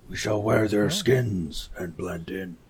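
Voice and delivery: slow, low growly bear. Acted. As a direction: speak it slowly like a bear. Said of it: "Your bear voice is wonderful".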